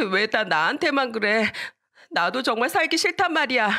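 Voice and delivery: high-pitched acted outburst of unfairness; aggrieved child's protest. Girl talks back to her grandfather, high aggrieved protest. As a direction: wail back that it's unfair.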